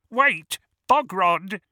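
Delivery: high, loud, drawn-out goblin call; urgent calling out. A narrator's reading. Another goblin calling out to stop.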